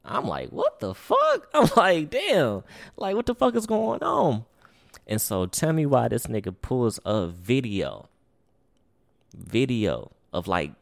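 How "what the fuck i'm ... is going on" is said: high, loud incredulous what the fuck; shocked disbelief. Retold or imitated. Telling friends how he reacted when the guard stopped him, high shocked disbelief. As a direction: voice jumps way up in disbelief. Pulled into office, retold reaction.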